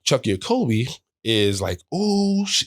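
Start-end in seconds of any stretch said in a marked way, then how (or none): 1.9-2.6 high, gleeful mock brag; bragging. Teammate bragging about the bill, high gloating. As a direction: crow over him like a teammate. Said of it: "bragging now".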